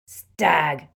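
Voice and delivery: one loud, scornful roar; explosive barman's shout. A narrator's reading. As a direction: roar the word back at them. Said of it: "roared the barman".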